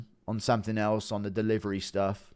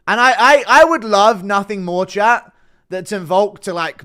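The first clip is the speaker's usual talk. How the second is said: loud, high eager gush; excited; 9 semitones higher, 14 dB louder than usual. Real, not acted. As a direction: burst out with eagerness. Eager wish about interview.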